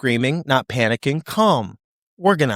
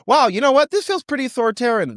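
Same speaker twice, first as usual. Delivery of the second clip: mock naive realisation; 12 semitones higher than usual. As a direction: play the slowpoke who finally notices the obvious. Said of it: "By the time people say".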